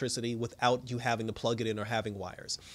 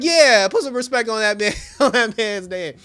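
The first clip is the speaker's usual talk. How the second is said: much higher, louder cheer with a laugh; cheering along; 8 semitones higher, 10 dB louder than usual. Retold or imitated. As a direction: raise your glass and whoop it. Joining the toast, cheering.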